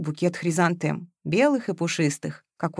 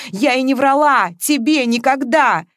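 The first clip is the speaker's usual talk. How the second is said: higher, louder, emphatic comeback; sharp retort; 6 semitones higher, 6 dB louder than usual. A narrator's reading. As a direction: throw the accusation back sharply. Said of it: "резко отвечала Маша".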